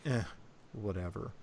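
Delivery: understated, indifferent brush-off; dismissive. Acted. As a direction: shrug it off quietly. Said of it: "but dismissive. Like, he's like".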